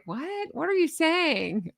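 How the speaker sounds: mock bafflement. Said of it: "It was just so like".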